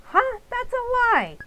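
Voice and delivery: indignant denial to dad; shocked protest. Acted. Min protests accusation.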